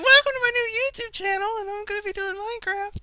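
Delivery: put-on high squeaky child voice; little kid voice. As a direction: play an eager young kid. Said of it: "Have, like, a little eight-year-old voice".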